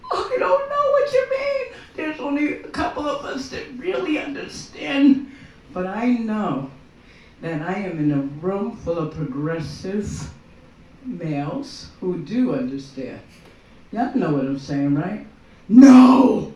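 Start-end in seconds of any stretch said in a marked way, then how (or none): 0.1-1.7 much higher, playing dumb; mock clueless room. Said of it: "this whole room is like". 15.7-16.5 loud, sharp "No!"; shouted no. Acted. Shouted refusal to the crowd.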